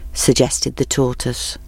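ordinary delivery

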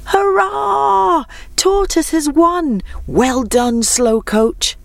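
high, drawn-out hurrah; cheering. A narrator's reading. Animals greet the winning tortoise at the clearing, cheering. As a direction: cheer the winner, pitched well up. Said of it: "the animals cheered".

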